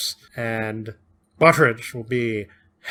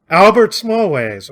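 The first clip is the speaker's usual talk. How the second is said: mock blurting; 8 semitones higher, 9 dB louder, slower than usual. Bert blurting out his name.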